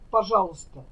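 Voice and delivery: ordinary talk, nothing marked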